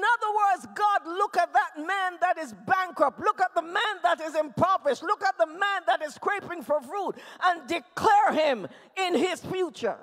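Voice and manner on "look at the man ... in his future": normal talking voice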